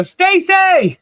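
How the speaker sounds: high-pitched name holler; playful demo of a shouted greeting; shouting a name out. Shown as a demonstration. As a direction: call it out loud and high, without anger. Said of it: "'cause I always go".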